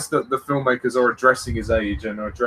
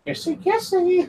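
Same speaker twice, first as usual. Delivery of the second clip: very high, drawn-out teasing coo; mock kissy squeal. Teasing as she kisses Bond, high mock-cutesy voice. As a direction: tease them in a slow squeaky singsong. Mocks the on-screen kiss.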